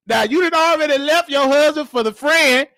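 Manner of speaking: ordinary talk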